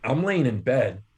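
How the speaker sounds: usual speaking voice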